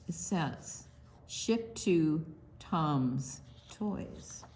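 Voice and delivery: ordinary delivery, nothing marked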